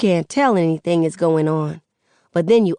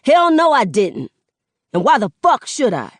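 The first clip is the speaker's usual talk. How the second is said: higher, loud, quickening snap; defiant son; 6 semitones higher, 5 dB louder than usual. Acted. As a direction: throw it back at her, defiant.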